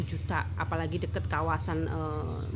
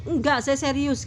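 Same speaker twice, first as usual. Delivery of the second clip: insistent; 8 semitones higher, 7 dB louder than usual. Retold or imitated. Friend insisting he's serious.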